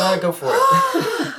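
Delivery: laughing through the words, high; cracking up while speaking. Real, not acted. First pancake goes wrong.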